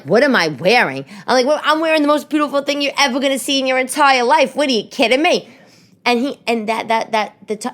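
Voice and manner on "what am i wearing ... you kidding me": high, rising mock outrage; indignant retort. Wife answering husband about her outfit, indignant retort. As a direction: fire back, loud and offended. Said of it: "And I'm like".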